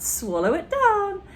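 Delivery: put-on excited boy urging; eager urging. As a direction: push her to swallow, gleeful. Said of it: "George cried out".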